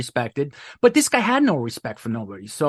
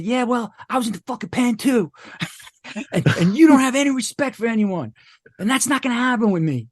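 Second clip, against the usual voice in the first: higher-pitched, heated retort; retold defiant comeback; 8 semitones higher than usual. Newcomer answers the old inmate back, retold defiance. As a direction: stand your ground, pitch up and pushing.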